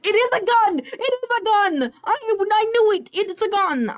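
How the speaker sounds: high, loud I-knew-it yell; triumphant shout. Real, not acted. Realises it is a gun.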